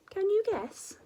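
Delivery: usual speaking voice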